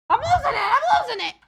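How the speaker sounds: high, loud, laughing outburst; laughing shout. Real, not acted. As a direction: yell it through laughter, losing it.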